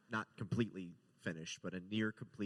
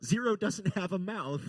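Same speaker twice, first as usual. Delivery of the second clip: sudden realisation; 10 semitones higher, 8 dB louder than usual. Retold or imitated. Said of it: "we're like".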